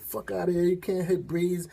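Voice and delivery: high mock voice of egging-on girls; jeering girls. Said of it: "the girls started getting into it".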